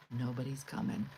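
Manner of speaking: ordinary delivery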